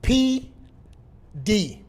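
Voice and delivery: firm, spaced-out letters for note-takers; slow dictation. Real, not acted. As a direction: spell it out slowly, letter by letter. Dictating three letters to write down.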